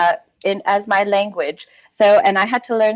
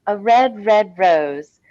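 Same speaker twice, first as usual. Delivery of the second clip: normal talking voice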